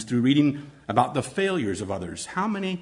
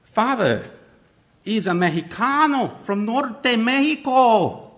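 a light mixed Mexican and British accent; a hint of a Mexican-British accent, higher; 6 semitones higher, 4 dB louder, slower than usual. Shown as a demonstration. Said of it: "Use different voices, subtle accents".

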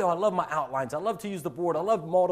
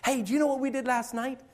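put-on high, bright-eyed kid bursting with news; eager student; 10 semitones higher than usual. Student eagerly telling the teacher about last night. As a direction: chatter away like an excited student.

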